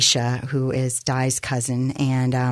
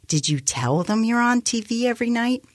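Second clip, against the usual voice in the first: high-pitched agitated demand; 10 semitones higher than usual. A narrator's reading. Office manager questioning his anchorwoman, agitated and high. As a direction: ask it in a fretful, raised pitch, as a narrator acting. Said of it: "Art was too agitated to listen".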